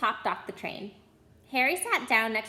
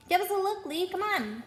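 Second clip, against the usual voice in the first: high, eager and pushy; eager call; 9 semitones higher than usual. Acted. Boy in the crowd begs Lee for a look, eager. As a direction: beg to see inside the box.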